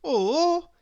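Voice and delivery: sharp, high, loud gasp of horror; horrified gasp. A narrator's reading. Tidy Miss Neat spots a puddle on her path, gasping in horror. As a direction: gasp "Oh!" as if the sight is appalling. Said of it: "she gasped in horror".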